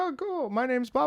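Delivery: high, polite meet-and-greet voice; imitated small talk. Streamer introduces himself to a fan, high polite voice. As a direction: introduce yourself in a high, eager voice.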